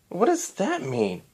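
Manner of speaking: high halting voice, stunned; stunned disbelief. Acted. Voices Cadance.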